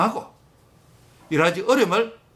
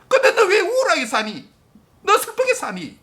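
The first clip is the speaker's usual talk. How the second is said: pleading, near tears; 7 semitones higher than usual. Acted. God pleads with gloomy believer.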